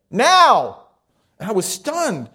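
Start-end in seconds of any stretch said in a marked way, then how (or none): 0.1-0.8 loud, high-pitched shout; shouted command. Retold or imitated. Old monk orders the newcomer to give himself now, shouting. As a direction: shout it out as a sudden, forceful order. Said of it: "But he shouted at me".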